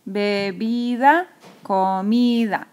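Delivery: "bebida, comida" slowly, stretching BI and MI; sílaba tónica alargada. Shown as a demonstration. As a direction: hold the middle syllable, be-BIII-da, co-MIII-da. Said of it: "Voy a subrayar la sílaba tónica". ("bebida, comida" lento, con BI y MI alargadas)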